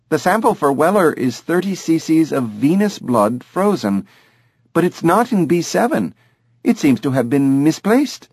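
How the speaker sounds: brisk, apologetic lab tech on the phone; Jenny the lab tech. A narrator's reading. Jenny reports missing sample by phone.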